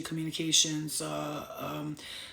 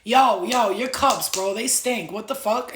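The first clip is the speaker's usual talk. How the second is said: loud call-out; 3 semitones higher, 9 dB louder, slower than usual. Retold or imitated. Gemini friend confronting host loudly.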